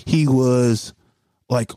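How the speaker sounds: ordinary delivery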